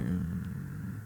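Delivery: very quiet low grunt; grumpy dad. Acted. Dad ignoring the guests, low quiet grunt. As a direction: barely grunt it, low and unwelcoming. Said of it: "he'd be like".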